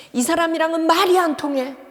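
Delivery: a spouse venting in frustration; exasperated complaint. Retold or imitated. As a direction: stress 말이, fed up with the spouse. Spouse complaining about partner.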